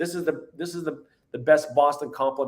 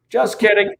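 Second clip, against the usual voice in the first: laughing; 6 semitones higher, 5 dB louder than usual. Real, not acted. Joke about pythons being sick.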